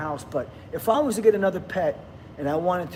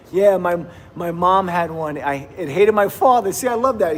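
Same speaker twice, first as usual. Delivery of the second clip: ordinary talk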